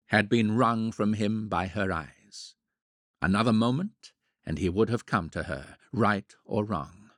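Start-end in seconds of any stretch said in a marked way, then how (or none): none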